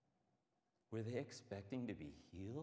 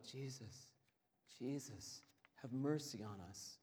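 a pleading call for mercy, lightly voiced; lepers calling out; 3 semitones higher than usual. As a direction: beg him from a distance, hoarse-voiced lepers. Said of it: "the lepers call out".

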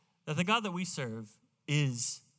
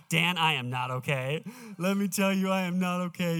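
louder, pleading retelling of his tears; retold weeping; 2 semitones higher, 4 dB louder than usual. Pastor retelling his tears to the flight attendant, a mock tearful plea. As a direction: lean into the words like you're breaking down again. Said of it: "and I'm weeping".

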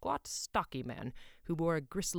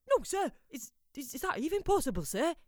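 put-on much higher, nervous boy's voice; stammering apprentice; 11 semitones higher, 5 dB louder than usual. A narrator's reading. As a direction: stumble over the words like a scared lad.

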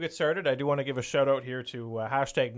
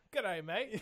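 put-on Australian accent; 6 semitones higher, 10 dB quieter than usual. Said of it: "I thought we were gonna do that one".

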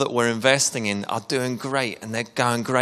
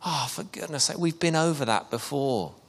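softer, higher, fed-up sigh of exasperation; weary exasperation; 3 semitones higher, 7 dB quieter than usual. Retold or imitated. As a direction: sound tired of repeating yourself. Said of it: "next minute, it's like".

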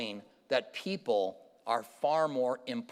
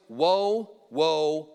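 loud proclaiming; 5 dB louder than usual. Acted. A preacher reads the eagle's warning to the earth with a loud call. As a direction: cry out the warning like a herald. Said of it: "says with a loud voice".

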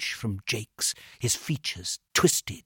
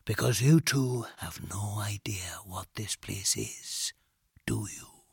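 very quiet; 2 semitones lower, 5 dB quieter, slower than usual. A narrator's reading. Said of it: "he said very quietly".

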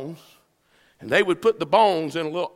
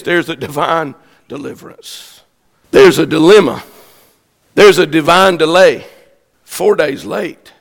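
ordinary talk, nothing marked

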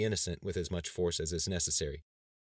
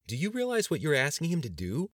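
high-pitched disbelieving dread; panicky, incredulous question; near-hysterical dread; 9 semitones higher, 4 dB louder than usual. A narrator's reading. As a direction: ask it aghast, pitch leaping high. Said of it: "wide-eyed dread that bordered on hysteria".

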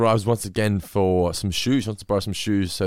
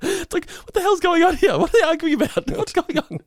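much higher, louder, incredulous; playing a bewildered viewer; mock bafflement; 4 dB louder than usual. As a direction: squeal your confusion. Baffled reaction voiced.

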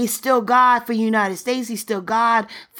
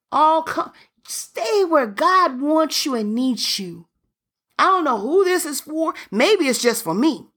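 ordinary talk, nothing marked

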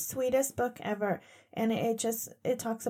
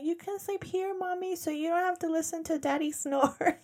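put-on sweet child voice; 7 semitones higher than usual. Voicing sweet kids offering bed.

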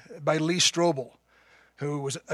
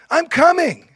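a much higher, loud ringing call; great cry; 12 semitones higher, 10 dB louder than usual. Retold or imitated. Dying man answers the angels with a full-voiced loud cry. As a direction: cry it out with everything left. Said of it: "with his great voice, he cried out".

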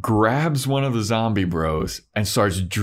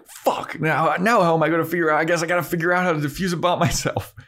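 fast, higher, tumbling words; flustered rush; 8 semitones higher, much faster than usual. Retold or imitated. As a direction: rattle it off in a panic. Xander flustered, rushing.

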